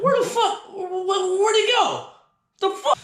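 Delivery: very high, stammering squeal of confusion; baffled disbelief. Retold or imitated. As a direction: squeak out bewildered questions. Acting out baffled search.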